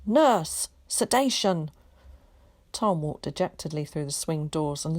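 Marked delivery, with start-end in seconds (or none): 0.0-1.7 urgent call. A narrator's reading. Sister calling for sedation.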